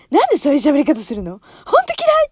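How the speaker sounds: loud, fast, high exasperated yell; mother's angry outburst. Retold or imitated. Mother yelling at the daughter's Minnie voice. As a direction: snap at her daughter in annoyance. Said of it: "とか言って".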